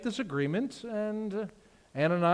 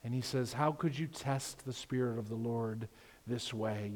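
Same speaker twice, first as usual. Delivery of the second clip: grave Peter; 5 semitones lower, 5 dB quieter than usual. Retold or imitated. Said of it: "And Peter says to her".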